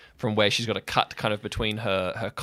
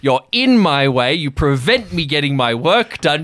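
loud, high, frustrated rant; angry outburst; 6 semitones higher, 4 dB louder than usual. Acted. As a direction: tear into him for overprotecting. Sky's angry outburst at Jeremy.

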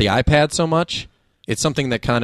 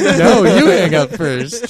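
slow, higher, cooing lover voice; giggly late night call; 5 semitones higher, 3 dB louder, much slower than usual. Pretend. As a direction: coo it like a smitten teen. Mock lovers' goodbye, sappy take.

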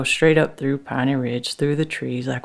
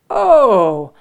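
loud, raised, put-on heartiness; loud fakey voice; 5 semitones higher, 6 dB louder than usual. A narrator's reading. Husband covering up before Officer Dave, loud fakey voice. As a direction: talk real loud and falsely for an onlooker. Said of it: "Frank start talking real loud, you know, using his fakey voice".

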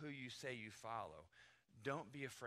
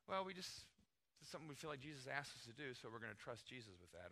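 softer, unforced reply; understated answer; 7 dB quieter than usual. Acted. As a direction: explain it modestly and casually. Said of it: "You could just say".

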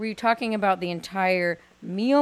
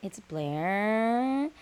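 creaky LA YouTuber voice; vocal fry; 8 dB quieter, much slower than usual. Pretend. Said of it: "kind of vocal fry is that".

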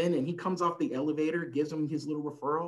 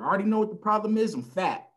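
boastful father; 7 semitones higher, 4 dB louder than usual. Retold or imitated. Father retells talk with doctor.

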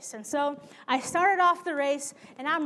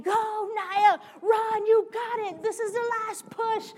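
high, loud cheering; cheering friends; 9 semitones higher, 6 dB louder than usual. Retold or imitated. Friends at the finish line cheering, loud and high. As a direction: yell her across the finish. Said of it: "all my friends standing right there saying".

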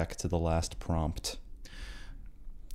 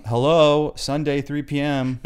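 loud, high, exasperated reminder; mock exasperation; 6 semitones higher, 6 dB louder, slower than usual. Podcaster parent to partner about recording time, exasperated. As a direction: play an indignant podcaster pointing out the obvious. Acting out scolding a spouse mid-recording.

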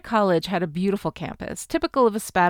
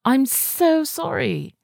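slow, high London apology; drawn-out sympathy in a chic London accent; Rose; 6 semitones higher, much slower than usual. A narrator's reading. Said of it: "her too chic for this place London accent".

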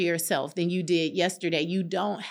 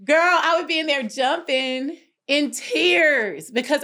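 higher and louder, slow and emphatic; excited; 7 semitones higher, 4 dB louder, slower than usual. Real, not acted. Telling her friend about the gym, excited and emphatic. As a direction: burst out with joy to your friend. Recalling joyful gym moments.

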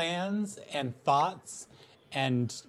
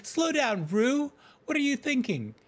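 urgent protest; 13 semitones higher, 10 dB louder than usual. Real, not acted. Stopping someone jumping ahead.

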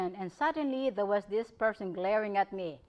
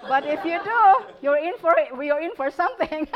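playful warning, louder and higher with a laugh; laughing mock threat; 6 semitones higher, 8 dB louder than usual. Real, not acted. Doctor joking to threatening patients, laughing mock threat. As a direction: tease them with a laughing threat.

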